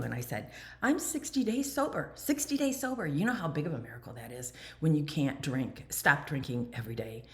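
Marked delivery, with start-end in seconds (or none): 0.8-3.1 higher, beaming boast; pumped up and proud. Retold or imitated. Newly sober woman boasting to a fellow member, proud and higher. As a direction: show off your sobriety, glowing. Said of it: "I was pretty pumped up pow-, and proud".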